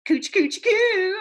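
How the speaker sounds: put-on high, loud baby-talk coo; playful cooing. A narrator's reading. Junie B tickling Lucille, high playful coo. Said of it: "real fun".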